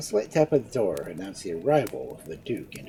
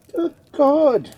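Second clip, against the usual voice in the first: loud, high cry of dismay; exclamation; 12 dB louder than usual. A narrator's reading. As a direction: burst it out, shocked and loud. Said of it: "exclaimed Edmund".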